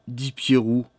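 ordinary delivery

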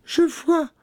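very high falsetto call; exclaiming. A narrator's reading. Sister reporting from the tower, high exclamation. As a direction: cry out from the tower in a woman's voice. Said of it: "s'écria-t-elle".

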